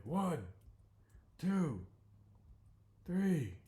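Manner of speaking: high, loud slow count; referee count. Acted. The ref counts the downed Bodhi out loud. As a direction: count him out like a ring ref.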